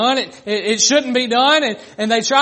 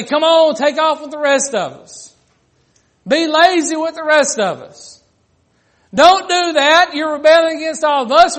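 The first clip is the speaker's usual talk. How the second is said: louder, higher taunting peer pressure; loud jeering, teasing the one who works; mocking coworkers; 6 semitones higher, 7 dB louder than usual. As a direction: egg him on like the lazy crowd at work. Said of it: "They mock you in what you're doing".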